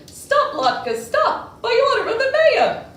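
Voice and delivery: high loud official decree; proclaimed loudly. Acted. The mayor loudly commanding the latkes to stop. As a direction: command it with authority. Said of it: "he proclaimed loudly".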